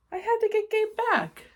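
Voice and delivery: put-on high, urgent kid voice; determined boy. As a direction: say it like a kid on a mission. Boy determined to retrieve monster.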